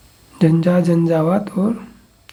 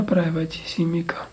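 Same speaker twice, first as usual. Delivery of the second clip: whisper; 7 dB quieter than usual. Real, not acted. Whispered repeat while memorising.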